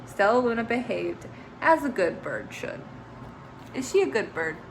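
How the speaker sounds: plain reading voice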